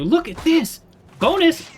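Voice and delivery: high-pitched gleeful call-out; excited exclamation. Real, not acted. Player spotting a bonus in the game, excited exclamation. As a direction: cry out at a lucky surprise.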